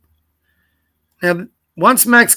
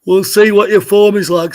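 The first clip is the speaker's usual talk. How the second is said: yawning; 2 semitones higher than usual. Real, not acted. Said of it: "Sorry for yawning".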